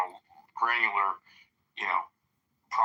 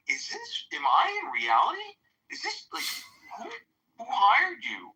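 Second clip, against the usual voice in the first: much higher mock incredulity; retold disbelief. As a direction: sound stunned the place hired this guy.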